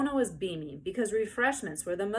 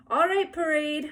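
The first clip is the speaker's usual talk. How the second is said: weary mother; 9 semitones higher, 8 dB louder than usual. A narrator's reading. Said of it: "said Mother wearily".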